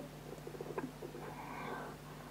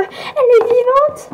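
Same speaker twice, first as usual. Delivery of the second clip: plus aigu, lent, émerveillé; lâche la nouvelle, bouleversée; annonce stupéfaite; 10 semitones higher, 5 dB louder than usual. Acted. (ton aigu d'annonce stupéfaite)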